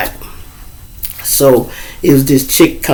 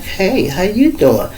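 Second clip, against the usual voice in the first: slow, drawn-out hello in her voice; her inviting greeting; 6 semitones higher, 4 dB quieter, slower than usual. Retold or imitated. The woman answering him with a slow, inviting hello. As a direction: stretch it out, inviting him to stay. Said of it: "the way she spoke back".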